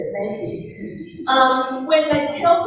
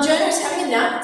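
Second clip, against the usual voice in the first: ordinary talk, nothing marked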